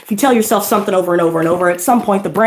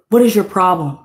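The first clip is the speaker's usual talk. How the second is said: usual speaking voice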